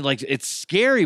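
plain reading voice

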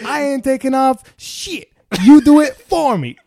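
high, building to a loud shout; retold shouted defiance; defiant refusal.

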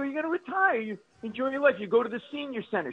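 high-pitched exasperated pleading; exasperated urging. Retold or imitated. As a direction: talk sense into a stubborn mother. Acts out arguing with elderly mother.

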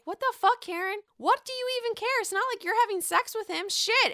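high, fast, indignant mock rant; mock outraged Gizelle. Gizelle snapping back at Karen, hammed-up high outrage. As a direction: squeal it out in a hammed-up huff. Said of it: "she's like".

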